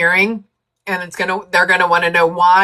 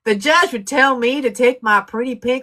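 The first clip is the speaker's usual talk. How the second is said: put-on Southern accent; 4 semitones higher than usual. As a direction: ham up a thick Southern country lawyer. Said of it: "a real thick Southern accent".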